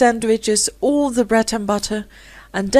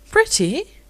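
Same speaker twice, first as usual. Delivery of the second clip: high, startled question; surprised; 10 semitones higher than usual. A narrator's reading. Rosemary surprised by Philip's praise, startled. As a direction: gasp the word in disbelief. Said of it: "so surprised that she blushed".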